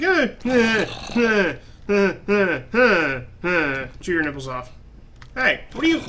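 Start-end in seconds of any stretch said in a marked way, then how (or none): 0.0-3.9 repeated short "eh" noises; mock creature grunting; imitated zombie grunts. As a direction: mimic a zombie straining to reach you. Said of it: "He's like".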